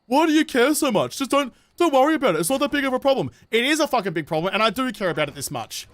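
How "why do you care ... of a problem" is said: higher, mocking voice of a critic; put-on higher voice, mockingly dismissive. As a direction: imitate someone brushing off your concern, pitched up. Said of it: "will be like".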